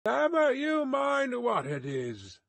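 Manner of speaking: high, called-back rebuff; guard's high-pitched retort; sharp retort. A narrator's reading. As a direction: shut down a distant stranger. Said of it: "the guard retorted".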